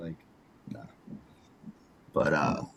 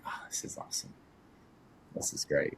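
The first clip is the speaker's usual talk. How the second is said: hushed, breathless whisper of joy; whispered delight; 7 semitones lower, 11 dB quieter than usual. Retold or imitated. Alone in the empty gym, whispering with delight. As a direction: whisper it like a secret treat.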